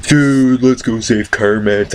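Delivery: high then slow, dopey; mock stoner friend; stoned friend voice. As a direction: drawl it out like a baked buddy. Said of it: "Benny's like".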